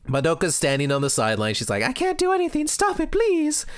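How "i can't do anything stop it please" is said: put-on pleading girl voice. Madoka begging the fighters to stop, high and pleading. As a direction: beg them to stop like a helpless girl.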